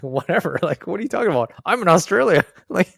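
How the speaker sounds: loud, high, laughing mock exasperation; laughing disbelief. Canadian mocking her friends' awe of Australia, loud laughing disbelief. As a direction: blurt it out through laughter, half incredulous. Mocking people back home.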